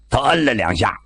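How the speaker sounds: ordinary delivery, nothing marked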